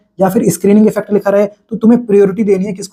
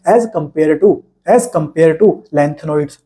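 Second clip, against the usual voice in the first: slow dictation; 4 semitones lower, much slower than usual. Shown as a demonstration. Teacher dictates a key phrase.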